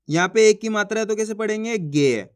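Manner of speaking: normal talking voice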